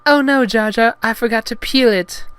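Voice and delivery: high, loud flustered falsetto; Padme, embarrassed. Acted. Heroine realizes her mistake, high loud embarrassed falsetto. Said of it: "She felt embarrassed".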